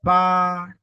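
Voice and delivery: "pa" held long, high and level; first tone. Shown as a demonstration. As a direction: keep "pa" high and even. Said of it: "le premier ton".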